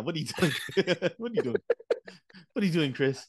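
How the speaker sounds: mock scolding. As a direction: playfully scold a friend's younger self. Mock scolding of friend's younger self.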